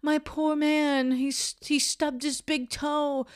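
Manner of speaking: dramatic fretting. Retold or imitated. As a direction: ham up a worried girlfriend fussing over a small hurt. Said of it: "dramatically going".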